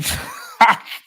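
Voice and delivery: loud, voiceless burst of laughter; real laughter. Real, not acted. Host reads a strange viewer message, bursts out laughing. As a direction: break up laughing, almost crying.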